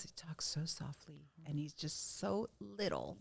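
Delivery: very hushed, demonstrating someone's soft voice; soft-spoken. Shown as a demonstration. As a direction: drop to a near whisper. Softly demonstrates how [name] talks.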